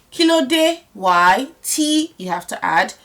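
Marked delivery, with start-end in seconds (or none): none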